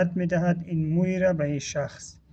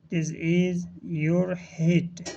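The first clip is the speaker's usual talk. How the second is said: slow, word-by-word teaching delivery; drawn-out words with small gaps between; slow model reading; much slower than usual. Shown as a demonstration. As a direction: model the phrase slowly for learners. Teacher models phrase for learners.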